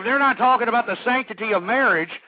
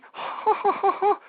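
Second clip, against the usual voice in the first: high-pitched smug chortle; high, put-on "ho ho ho"; mocking fake laugh; 10 semitones higher than usual. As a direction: laugh like a self-satisfied bigshot. Fake laugh ending the mocking impression.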